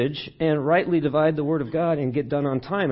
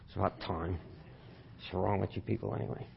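much softer and lower, a weary exasperated mutter; fed up; 6 semitones lower, 13 dB quieter than usual. Shown as a demonstration. Pastor demoing a disgusted take for the congregation, muttered. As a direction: grumble it under your breath. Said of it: "when I say it that way".

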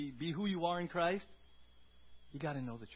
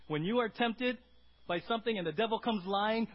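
ordinary talk, nothing marked

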